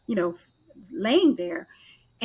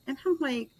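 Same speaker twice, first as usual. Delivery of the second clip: normal talking voice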